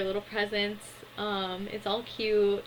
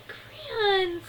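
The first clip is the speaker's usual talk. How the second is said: gasp, then a very high kiddie cry; high-pitched imitation of childish delight; delighted mock squeal; 13 semitones higher than usual. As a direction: squeal it like a thrilled toddler.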